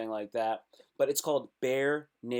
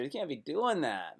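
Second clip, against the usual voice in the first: normal talking voice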